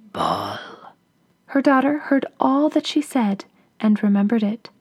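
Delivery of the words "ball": hoarse whispering ogress; low rasping witch whisper. A narrator's reading. Ogress ends speech.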